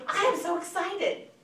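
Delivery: high, loud, gushing announcement; excited tease to hook a friend; enthusiastic. Shown as a demonstration. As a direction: burst out with thrilled enthusiasm. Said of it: "you have to have enthusiasm".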